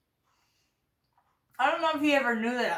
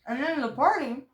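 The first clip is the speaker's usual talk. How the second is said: mouth full; 4 semitones higher than usual. Real, not acted. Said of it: "I'm sorry, I'm chewing with".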